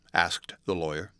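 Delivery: plain reading voice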